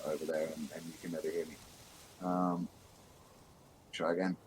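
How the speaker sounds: ordinary talk, nothing marked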